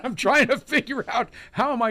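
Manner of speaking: high, laughing through the words; amused, giggly admission. Real, not acted. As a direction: let a real chuckle lift the line. Laughs about washing the hat.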